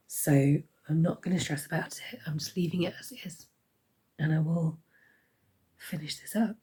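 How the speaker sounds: hoarse whisper. Real, not acted. Sick vlogger with failing voice.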